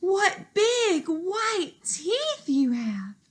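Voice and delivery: put-on high, slow, wondering girl voice; a curious little girl peering close.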